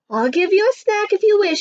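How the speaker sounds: high-pitched storybook woman; giant's wife. Acted. Giant's wife offering Jack a snack, high character voice.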